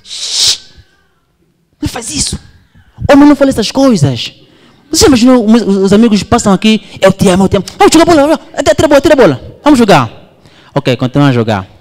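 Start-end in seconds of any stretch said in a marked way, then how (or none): 0.0-0.7 a sharp whispered shh; hushing whisper. Acted. Brother hushing his sibling. 1.8-10.3 high, loud, mocking brush-off; embarrassed big brother waving it off; scolding big brother.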